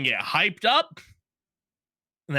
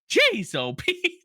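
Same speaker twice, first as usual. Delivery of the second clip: high, drawn-out exclamation of dismay; mock exasperation; much slower than usual. Host reenacting his reaction to season two, high exasperated exclamation. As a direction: stretch it out high, in playful disbelief.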